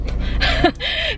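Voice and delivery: short high-pitched laugh; a quick squeal of laughter. Real, not acted. Laughing at own story.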